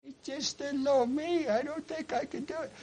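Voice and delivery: high, soft, small put-on voice; timid excuse. Believer excusing himself to God, high and soft. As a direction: shrink into a tiny pleading voice.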